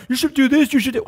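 high, loud mimicry of naive advisers; mocking imitation. Mimicking people who don't understand, high mocking voice. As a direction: parrot their pushy advice in a high voice.

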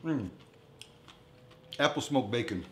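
ordinary talk, nothing marked

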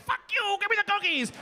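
high-pitched mock-angry yelp; defiant outburst. Man to the girl warning him, defiant outburst. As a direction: snap back in a high, comic rage.

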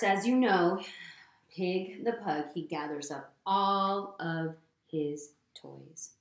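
plain reading voice

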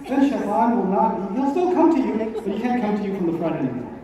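ordinary talk

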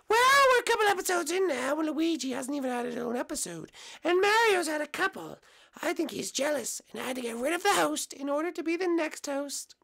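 high, loud cartoon mushroom voice; squeaky eager little guy; Toad puppet voice. Toad explaining his theory.

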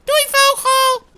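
high, loud, drawn-out farewell; shouted goodbye. Acted. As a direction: holler a slow squeaky bye. Calls goodbye to a bird.

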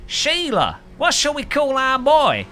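high, hammed-up Aussie parent; bad Australian accent. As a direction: put on a terrible, high-pitched Australian accent. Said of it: "this is the worst Australian accent I've ever tried".

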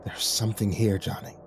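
soft, trembling fear. Acted. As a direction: let the voice tremble a little. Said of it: "a slight tremble in his voice".

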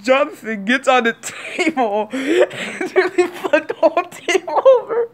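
high, loud laughter through words; can barely get it out for laughing; laughing hard. Real, not acted. Telling viewers about the flipped table, laughing hard.

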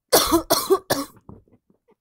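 loud, high, rasping cough; coughing hard on a sore throat; real painful coughing fit; harsh cough. Real, not acted.

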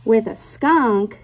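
lilting picture-book read-aloud; reading to children. Acted. Teacher reading a rhyming book to her class, lilting read-aloud. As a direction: read the rhyme to little kids, savoring each line.

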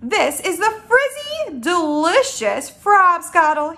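high start, slow and relishing; drawn-out, gleeful proclamation; excited shout. Acted. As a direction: proclaim it, stretching each word. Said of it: "he shouted".

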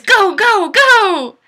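high, loud rallying yell; hammed-up cheer as Destiny; cheering shout. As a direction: cheer her on at full volume. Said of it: "And says".

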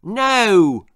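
high, loud stubborn shout; loud defiant no. A narrator's reading. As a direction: yell it back defiantly. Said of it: "shouted Simon".

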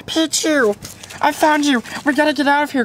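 usual speaking voice

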